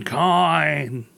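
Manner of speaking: dusty mummy rasp, rising; mummy coin voice. Pretend. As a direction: moan it like a mummy. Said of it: "Can you throw that in one more time".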